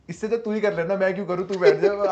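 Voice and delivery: irritated order, acted out; irritated retort. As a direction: snap back as in a retold squabble. Retold squabble between candidates.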